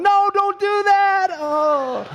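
high, slow, loud no don't do that; anguished mock yell; pleading protest. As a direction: cry out to stop him paying. Stranger pays for his coffee.

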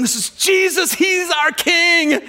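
high, loud jubilant shout; crowd's cheer. Acted. Crowd hailing Jesus as king, shouted high and loud. Palm sunday crowd celebrating.

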